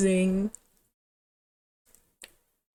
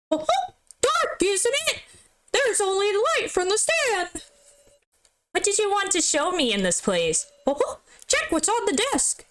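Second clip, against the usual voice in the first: much higher, cheerful showing-around voice; high guide character; 10 semitones higher than usual. Acted. Guide So shows Sarah the hidden room, much higher and friendly. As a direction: play the friendly guide leading her in.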